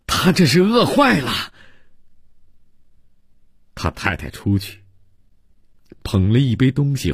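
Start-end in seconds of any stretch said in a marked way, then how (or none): 0.1-1.5 high-pitched alarmed cry; sudden exclamation. Acted. Host realises what ails his fainted guest, sudden exclamation. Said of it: "伯罗姆叫了一声".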